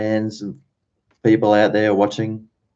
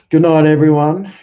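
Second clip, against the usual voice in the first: cheery sign-off; 8 semitones higher, 7 dB louder than usual. Real, not acted. Signing off the stream loudly.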